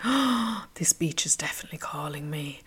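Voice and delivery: breathless whisper after a gasp; soft, unvoiced, starry-eyed whisper; awed whisper. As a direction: hush it like a secret longing. Awed inner voice at beach picture.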